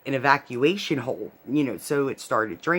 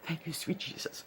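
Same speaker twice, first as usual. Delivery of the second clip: softer, breathy thanks under the breath; relieved prayer; 15 dB quieter than usual. Retold or imitated. Patient relieved the drilling is over, thanking Jesus softly. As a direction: sigh it out like a quiet prayer of relief. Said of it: "I'm like".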